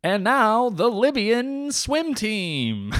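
mock Olympics announcer introducing a team. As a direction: draw it out like a stadium announcer.

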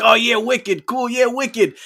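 loud, high mock Boston accent. Guest mimics his crew after PAX, obnoxious Boston accent. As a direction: imitate annoying friends with put-on Boston accents. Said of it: "end up with these Boston accents".

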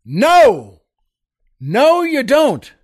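sharp loud yell of no; snapping shout at the mascot. Retold or imitated. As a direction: bark it out loud and high. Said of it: "I shouted".

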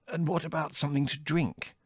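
high, hoarse plea from a thirsty man. A narrator's reading. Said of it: "inquired Adrian hoarsely".

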